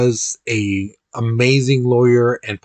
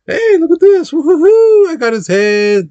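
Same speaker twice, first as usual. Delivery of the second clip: much higher, loud whooping glee; gleeful crowd; 7 dB louder than usual. Retold or imitated. Revolutionaries parading the king's head, high gleeful whooping. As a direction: crow over the trophy like a giddy mob. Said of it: "they're just like".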